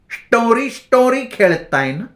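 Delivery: high, rising, sly voice; madwoman quizzing. A narrator's reading. Bhagi teasing the boys about their game, high.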